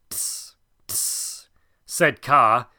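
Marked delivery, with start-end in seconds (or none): 0.1-1.5 soft unvoiced tss tss of a snake; a python's hushed clucking disapproval; disapproving tsk. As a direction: tut quietly while shaking the head. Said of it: "shaking his head to and fro".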